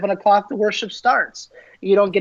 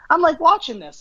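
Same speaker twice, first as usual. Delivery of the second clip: normal talking voice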